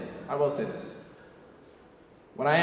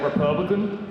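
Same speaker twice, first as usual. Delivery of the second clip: tag quoted classmate; 5 semitones higher than usual. Retold or imitated. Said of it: "He's like".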